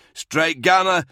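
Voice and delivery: loud, high call with a falling end; yelling. A narrator's reading. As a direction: yell the call sign for help. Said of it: "Dower yelled".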